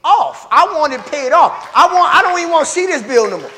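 loud, rising, pleading prayer; a churchgoer praying loudly to God; mock bold prayer. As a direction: pray it big and demanding. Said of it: "you come up in there and you say".

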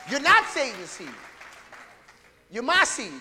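loud, rising pulpit preaching; preaching at full force. Acted. Preacher exhorting his church, full-force preaching. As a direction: drive it home to the congregation.

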